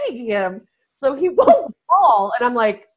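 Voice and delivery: normal talking voice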